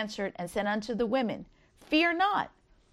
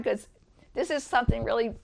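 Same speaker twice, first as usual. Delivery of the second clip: normal talking voice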